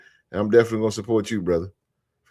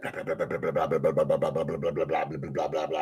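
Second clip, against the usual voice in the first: imitating fast typing; 7 semitones lower, 7 dB quieter than usual. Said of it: "My wife, she be doing that".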